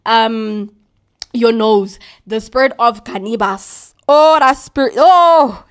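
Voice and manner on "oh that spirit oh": rising, louder cry of warning; excited outburst. Real, not acted. Preacher warning listeners about cannabis, loud excited exclamation. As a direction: burst out as if the spirit stirs you.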